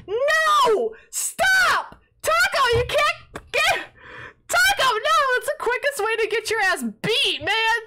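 very high, loud shriek of protest; frantic yelling. Real, not acted. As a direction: shriek at a friend to stop. Yelling at Taco to stop gifting.

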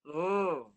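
"Rrr" as a heavy sustained trill; thick Arabic ra. Shown as a demonstration. Teacher has student copy the sound, thick rolled r. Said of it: "Ya, bunyi dia tebal".

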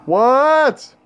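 sharp, high startled yelp; startled what. Real, not acted. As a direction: jump at the scare. Sudden scare in game.

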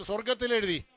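a sudden loud ringing proclamation; preaching shout. Real, not acted. Preacher at a funeral proclaiming to mourners, loud ringing shout. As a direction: cry it out to the mourners like a revelation.

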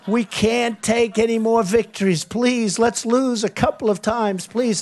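lightly hammed-up plea to lose; begging voice. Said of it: "you're gonna be begging me".

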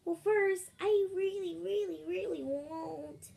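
high, loud little seal voice; changed seal voice. Pretend. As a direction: pitch it up as a pretend baby seal. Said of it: "let me try to change my voice".

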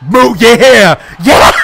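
loud whoop breaking into laughter; triumphant cheer. Real, not acted. Player celebrating a big hit, triumphant.

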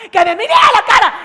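furious shout. Acted. Girlfriend screaming at her boyfriend in the park, furious shout. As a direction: yell it at his face. Acted quarrel, repeated as shout.

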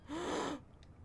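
quick, high gasp; delighted gasp. Real, not acted. Player spotting a toy in the water, delighted gasp. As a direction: draw breath in sudden delight. Spots a rubber ducky.

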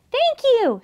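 high little thank-you squeak; small creature, pitched high; squeaky mouse. A narrator's reading. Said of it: "squeaked Mouse".

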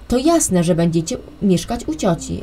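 usual speaking voice

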